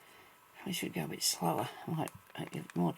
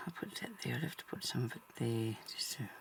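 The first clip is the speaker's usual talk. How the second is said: whispering; 2 semitones lower, 7 dB quieter than usual. Real, not acted. Quiet crafting aside.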